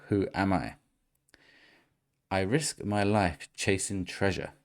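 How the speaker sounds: usual speaking voice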